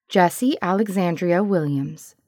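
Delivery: ordinary delivery, nothing marked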